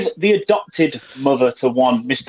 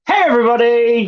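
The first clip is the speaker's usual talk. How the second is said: excited greeting; 9 semitones higher, 3 dB louder than usual. Acted. Host opening show, high bright greeting.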